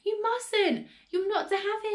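high, urgent pleading; alarmed cry. A narrator's reading. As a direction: warn her off desperately. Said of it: "George cried".

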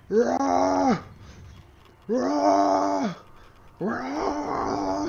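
loud high straining yells; cranking roars; effort yells. Real, not acted. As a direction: grunt out big, loud effort shouts. Yelling while cranking a wheel.

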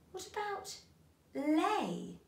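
usual speaking voice

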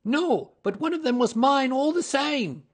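very high, loud whine; whining. A narrator's reading. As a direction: protest like a wronged little man. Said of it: "whined the little man".

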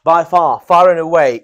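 BY FAR and FAR AND AWAY both loud; emphatic stress. Shown as a demonstration. Teacher recapping the emphatic phrases, loudly. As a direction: punch "By far, far and away" as a list. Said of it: "more emphasis to your superlative".

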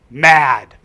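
loud, curt one word; blunt title. Retold or imitated. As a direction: drop the title like a verdict. Girl names her song.

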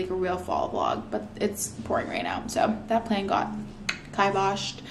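ordinary talk, nothing marked

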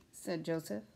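plain reading voice

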